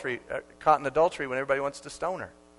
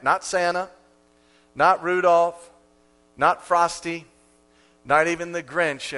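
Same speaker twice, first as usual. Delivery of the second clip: ordinary talk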